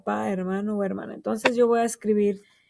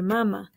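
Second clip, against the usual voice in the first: mamá mispronounced as MA-ma, first syllable stressed. Shown as a demonstration. Teacher showing children the word without its accent, mamá stressed up front. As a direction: say "mamá" as if unaccented, MÁ-ma. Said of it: "Si no tiene acento, hubiera de decir".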